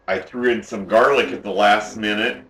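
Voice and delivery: ordinary talk, nothing marked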